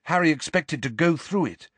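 plain reading voice

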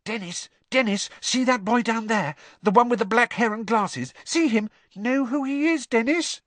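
high-pitched eager urging; excited boy. A narrator's reading. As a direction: pester your brother to look, piping voice. Excited boy points someone out.

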